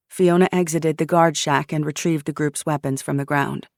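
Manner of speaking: ordinary delivery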